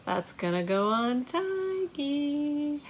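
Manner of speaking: normal talking voice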